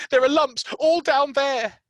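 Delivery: high, loud insistent whimper; cling to his fear; sick boy insisting on his lumps; frightened boy. Acted. Colin frightened.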